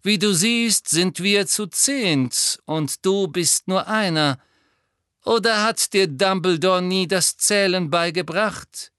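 high, taunting, deliberate sneer; mock the outnumbered boy with relish; smug, superior villain voice; gloating villain. A narrator's reading. Malfoy mocks Harry.

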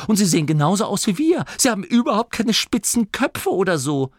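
tumbling words of wonder; excited babble. A narrator's reading. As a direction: rattle it off in amazement. Angelo marvels at newcomers.

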